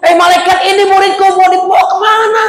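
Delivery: very high, anguished calling out; tearful cry. Acted. Weeping teacher challenging angel, high distressed cry. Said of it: "nangis dia".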